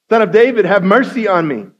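a high, loud, desperate cry. Acted. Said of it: "he shouted all the more".

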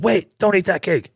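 high, light imitation of a little girl; daughter's voice. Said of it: "And my daughter Leah said".

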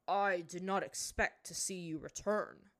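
distant, icy father; cold and detached. A narrator's reading. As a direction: hold her at arm's length. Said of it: "cold and detached".

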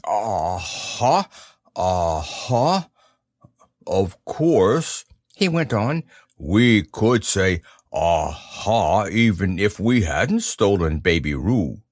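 low, slow, knowing Rabbit; lightly voiced scheming rabbit, drawn out; sly, measured rabbit. A narrator's reading.

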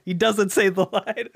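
laughing through the words. Real, not acted. Telling the bait and switch joke, laughing. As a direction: crack up while saying it. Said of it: "repeated laughing".

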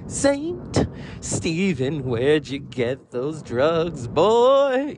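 slow, drawn-out hippie-singer impression. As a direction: stretch each word, swooping up loud at the end. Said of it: "dressed up like [name]".

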